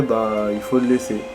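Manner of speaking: ordinary delivery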